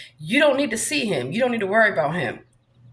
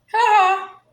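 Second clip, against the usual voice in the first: mocking laugh; 9 semitones higher, 4 dB louder than usual. Real, not acted.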